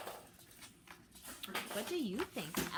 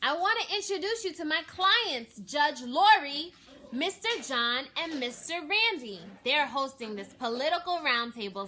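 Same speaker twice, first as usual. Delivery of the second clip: loud introduction; 8 semitones higher, 11 dB louder than usual. A narrator's reading. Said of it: "stated Chef".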